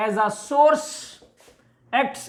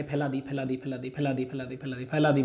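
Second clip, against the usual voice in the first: low, very soft repeated patter; hushed repetition; 8 semitones lower, 11 dB quieter than usual. Shown as a demonstration. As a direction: repeat it under your breath, low and even. Mimics wavelets spreading one after another.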